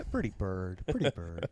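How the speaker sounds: squawky pet bird voice; playful parakeet impression; parrot voice. Imitating the parakeet.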